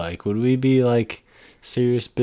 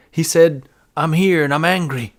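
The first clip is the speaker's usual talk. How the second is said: mock dramatic; 5 semitones higher than usual. Mocking ghost hunters hearing a fart, dramatic. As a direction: announce it like a thrilled ghost hunter.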